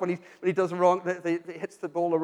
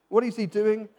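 normal talking voice

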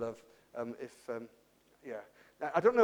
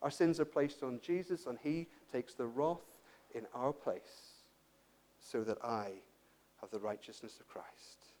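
soft, low, reverent wonder; hushed preaching; 6 semitones lower, 13 dB quieter than usual. Real, not acted. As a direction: let it sink almost to a murmur, with long pauses.

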